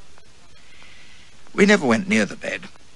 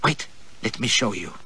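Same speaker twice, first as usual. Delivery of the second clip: softer, a hint of breathless panting; panted; 6 dB quieter than usual. A narrator's reading. Girl breaking off the kiss, panting softly. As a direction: pant it out softly, half out of breath. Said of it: "she left off gnawing at me and panted".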